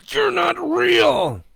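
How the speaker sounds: slow, very high yell; defiant shout. A narrator's reading. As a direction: scream it at the monster. Joe shouts at monster.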